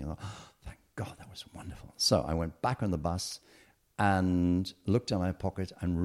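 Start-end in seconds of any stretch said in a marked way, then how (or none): 0.2-1.9 whispered sigh of relief, acted out; barely voiced, thankful whisper; relieved whisper. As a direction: breathe it out in a hushed whisper. Relieved aside in a story.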